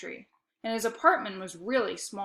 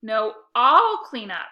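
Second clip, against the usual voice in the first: slow and much louder, digging in; insisting; 9 dB louder, slower than usual. A narrator's reading. Boy refusing to let his sister win. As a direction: stubbornly claim the job. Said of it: "I insisted".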